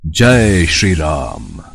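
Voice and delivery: slow, low, resonant god voice; divine proclamation. Acted. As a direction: proclaim it like the deity himself. Hanuman reveals true form.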